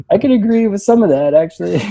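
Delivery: laughing. Real, not acted. Laughing while reading review.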